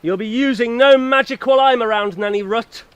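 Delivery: loud, high, defiant warning; stern loud challenge. Acted. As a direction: stand up to the witch. Hero confronts witch.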